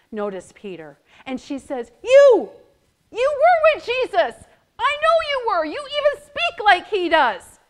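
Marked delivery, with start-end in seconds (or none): 2.0-7.6 put-on insistent accusing servant girl.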